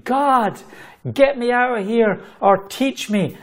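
high-pitched retold plea to God; proclaiming. Overwhelmed new worker begging God for rescue, proclaiming. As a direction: proclaim it high, with urgent emphasis. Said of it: "here I am proclaiming".

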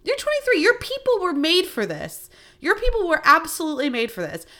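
high-pitched mock outrage, way up in the voice; incredulous comeback. Telling young cousin at the bar, high mock outrage. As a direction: squeal it like it's obvious. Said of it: "And I'm like".

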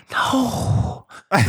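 drawn-out mock-singer "no"; mimicking R. Kelly. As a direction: stretch it out, mimicking his odd delivery. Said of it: "does he talk like that?".

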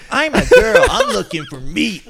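high, laughing girly impression; mock girly voice. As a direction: play a squeaky valley girl. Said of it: "some people say".

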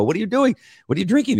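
high, teasing mock voice; buddies' mock voice. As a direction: mimic the buddies poking fun.

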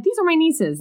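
high, bright insistence; chirpy aunt. Retold or imitated. Aunt introducing the girls to her nephew, chirpy and high. Retelling aunt's bright claim.

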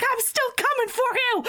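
high-pitched, hammed-up battle cry; mock menace. Freezing girl to the man she is fighting, mock menacing.

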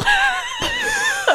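high-pitched burst of laughter; long, high giggle. Real, not acted. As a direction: crack up at your own pun, high and squealing. Cracking up at her own pun.

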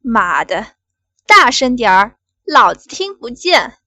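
loud, angry yell; loud furious order; angry shout. Acted. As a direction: yell at her to speak up. Boss yelling for louder.

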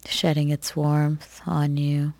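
ordinary talk

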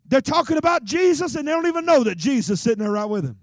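loud, high preaching. Acted. Preacher fires up the congregation, loud and high. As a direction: preach it to the crowd.

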